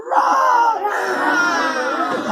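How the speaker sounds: playful roar to copy; bear roar demo. Shown as a demonstration. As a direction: show them the bear's roar. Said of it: "Can you all go".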